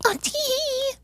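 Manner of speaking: high, giggly airhead voice; mock ditzy girl. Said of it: "that girl's dumb 'cause she was like".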